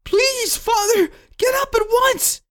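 put-on very high-pitched, desperate boy; tearful pleading. A narrator's reading. Boy leans over his fallen father, tearful urging. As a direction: beg as a child in tears. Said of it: "with tears streaming".

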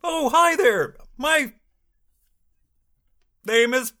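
high, hearty character greeting; [name]. Acted. Ron greeting listeners at his workshop, high character voice. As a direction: lift the hello up high. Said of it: "I'm [name]".

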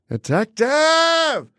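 long high scream of detective. Retold or imitated. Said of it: "funny to hear [name]".

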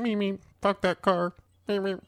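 high, quiet car growl; Herbie car voice. Pretend. Podcast host voicing jealous Herbie, quiet car growl.

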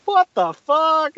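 high, drawn-out disbelief; mock astonishment. Player reacting to starting the multiball, acted astonishment. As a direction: stretch it out high in mock shock.

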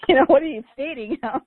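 high, fast, laughing through the words; laughing retelling. Retold or imitated. Host quoting husband while laughing, high and fast. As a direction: bubble over while quoting him. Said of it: "He goes".

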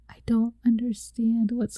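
hushed, breathy whisper of bewilderment; whispered confusion. Retold or imitated. Retelling being tailgated, whispering to herself. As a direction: whisper it to yourself, scared. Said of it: "And I'm like".

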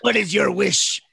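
lightly voiced menacing genie; a hint of a sinister wish-granter. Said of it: "this guy's just there and he goes".

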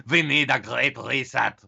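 slow, lightly voiced German accent; a hint of a Klaus Schwab German accent, said slowly; Klaus Schwab impression. Host mocking Klaus Schwab's call for reset, light German accent. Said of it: "my Klaus Schwab impression is not great".

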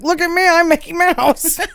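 high squeaky cartoon mouse voice; Mickey Mouse voice. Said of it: "they were like".

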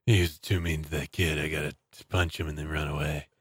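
low, quiet gruff voice; low tough guy. Pretend. Mock Seagal voice.

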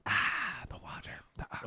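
hushed, voiceless relish; very soft, playful whisper after a sip; savouring whisper. Pretend.

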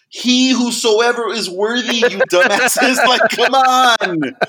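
hammed-up outraged shouting at the audience; mock scream. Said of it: "I wanna scream".

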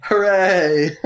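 a bright, high little mock hooray; mock cheer. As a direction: cheer it up high, half joking. Mock cheer at click flavors.

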